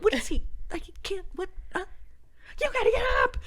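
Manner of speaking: high loud frantic shouting; frantic yell. Retold or imitated. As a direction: scream at him to get up. Panicked yelling at husband.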